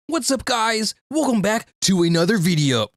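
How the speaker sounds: high, hyped video intro; fake content creator voice. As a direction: put on a fake, overdone creator greeting. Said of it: "the fake content creator voice".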